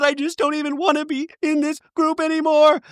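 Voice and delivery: high, strained mock wail; mock tearless crying. Housewife retold by recapper wailing at her friends, mock crying. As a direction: wail it in a put-on tearful pitch. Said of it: "No tears still coming out of the eyes".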